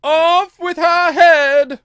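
high, very loud, drawn-out yell; full-force royal shriek; shout at the top of the voice. A narrator's reading. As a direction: scream the death sentence as the queen. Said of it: "shouted at the top of her voice".

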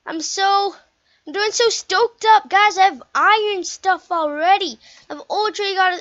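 high and loud with excitement; excited gamer telling viewers about iron gear; stoked. Real, not acted. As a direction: bubble over about the gear.

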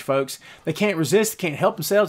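ordinary talk, nothing marked

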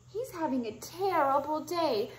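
usual speaking voice

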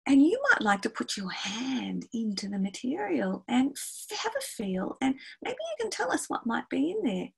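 soft, high, inviting voice; hushed, lilting invitation to a young pupil; coaxing teacher. Acted. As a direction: gently draw a shy child into a mystery. Teacher coaxing a child forward.

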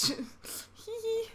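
high, slow sneaky giggle; giggling. Real, not acted. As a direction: giggle like a little mischief-maker. Giggles at her doing.

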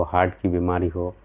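normal talking voice

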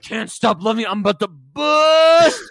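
overplayed desperate lover, slowing at the end; hammed-up Anakin. Mocking Anakin's confession to Padme, hammed-up and loud. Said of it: "He's like".

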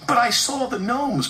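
high-pitched, insistent protest; indignant little engine. A narrator's reading. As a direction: object earnestly to being doubted. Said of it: "protested Percy".

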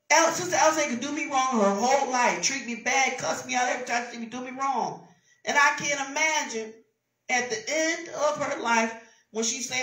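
ordinary delivery

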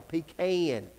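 pecan as pee-KAN, for outsiders; Southern pecan. Shown as a demonstration. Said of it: "let me help all y'all".

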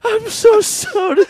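very high, loud, slow squeal through laughter; mock falsetto apology. Embarrassed caller apologising again to operator, squealing. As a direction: squeal it in hammed-up distress.

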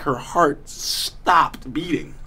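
usual speaking voice

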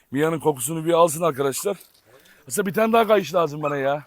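ordinary talk

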